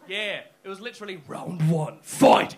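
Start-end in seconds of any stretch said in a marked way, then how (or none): 1.3-2.5 loud, punched fight call; mock fight announcer. Comedian likening a seat argument to a bout, mock announcer shout. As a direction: shout it like a round opener.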